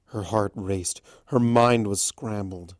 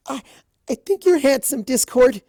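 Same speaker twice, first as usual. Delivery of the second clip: much higher, louder blurt; blurted confession; 7 dB louder than usual. A narrator's reading. Fluttershy blurting her confession to Discord, loud. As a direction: force it out eyes shut. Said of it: "screwed her eyes tightly shut".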